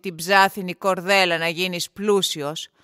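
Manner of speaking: loud, low voice of authority; commanding nobleman, deep and forceful; stern lord. A narrator's reading. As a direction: lay down a challenge to the poor suitor. Lord sets suitor a challenge.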